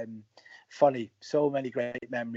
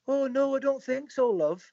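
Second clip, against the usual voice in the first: Pat's reply; 11 semitones higher than usual. Retold or imitated. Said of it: "And Pat goes".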